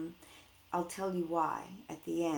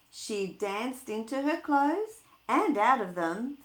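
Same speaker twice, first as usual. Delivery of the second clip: lively, louder storybook read; reading to children; 2 semitones higher, 6 dB louder than usual. Acted. Teacher reading a picture book to her class, lively. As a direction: bring the picture book alive for kids.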